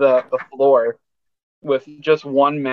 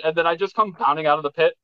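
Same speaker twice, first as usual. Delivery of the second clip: ordinary talk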